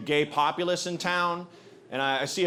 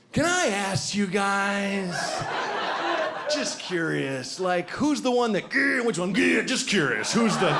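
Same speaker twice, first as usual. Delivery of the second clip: put-on slow, wheedling drunk curiosity; pry clumsily, then growl with eagerness; drunk dude prying; 5 semitones higher than usual. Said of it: "and he's like".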